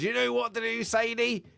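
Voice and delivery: squeaky imitation giving Sadie an order; high mock voice. Retelling the show, voicing him telling Sadie, high mock voice.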